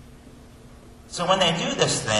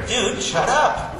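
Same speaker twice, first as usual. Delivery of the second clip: higher, drawn-out telling-off; mock exasperated rebuke; 8 semitones higher, much slower than usual. God telling Peter to shut up, exasperated. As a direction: slowly tell him off, half in jest. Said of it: "As if to say to Peter".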